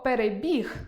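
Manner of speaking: «перебіг» stressed on final «біг»; stress on last syllable. Shown as a demonstration. Teacher giving the verb to learners, «перебіг» stressed on «і». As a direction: say «перебіг» with the weight on «і». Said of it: "наголос буде падати на «і»".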